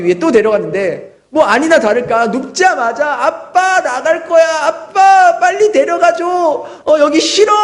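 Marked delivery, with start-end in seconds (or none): 3.3-7.5 put-on higher, pleading little-boy voice; crying child. Pastor voicing his son begging to leave the dentist, higher pleading. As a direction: beg like a scared toddler to be taken home. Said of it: "막 소리치고 울고 불고".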